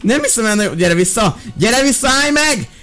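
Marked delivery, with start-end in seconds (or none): 1.6-2.7 calling out. Pretend. Teacher calling after fleeing student.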